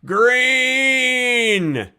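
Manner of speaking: high, long yelled call; heckler's call from the back row. Retold or imitated. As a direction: bellow it across a cinema. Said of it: "who would just go".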